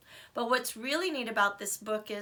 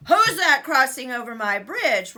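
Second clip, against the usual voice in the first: booming troll challenge; deep scary troll roar; 3 semitones higher, 12 dB louder than usual. Acted. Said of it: "roared the mean old troll in his deep, scary voice".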